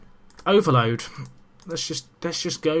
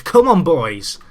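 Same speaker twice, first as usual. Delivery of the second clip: loud cheer; 3 semitones higher, 8 dB louder, slower than usual. Real, not acted. Cheering his players after the penalty, a loud real shout. Celebrating equaliser with team.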